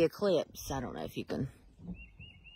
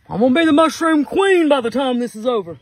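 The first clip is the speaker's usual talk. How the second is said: excited declaration; 7 semitones higher, 9 dB louder than usual. Real, not acted. Vows to find mushrooms.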